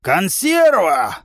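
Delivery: drawn-out giant's cry; joyful roar. A narrator's reading. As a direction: roar with delight at spotting prey. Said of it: "радостно взревел здоровяк".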